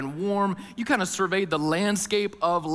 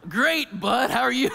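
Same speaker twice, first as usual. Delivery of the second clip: laughing; 7 semitones higher, 4 dB louder than usual. Retold or imitated. Forced cheer while exhausted.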